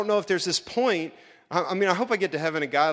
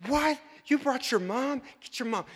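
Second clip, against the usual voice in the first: mock outrage; 9 semitones higher than usual. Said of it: "they're totally ticked".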